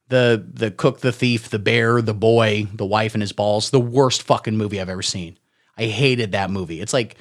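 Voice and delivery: usual speaking voice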